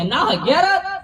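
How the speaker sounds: high comic disbelief; imitated incredulous wife. Wife phoning husband from her parents' house, high mock disbelief. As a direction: question it high, mock suspicious. Suspicious wife on phone, acted.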